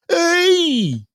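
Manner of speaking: high sharp "Hey!" like a ref's whistle; referee shout. Acted. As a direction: call out the foul. Calling a foul on a dude.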